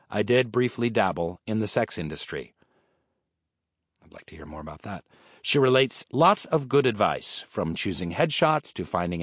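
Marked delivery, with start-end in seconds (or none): 4.0-5.0 dry aside. Acted. Aside after sex industry line.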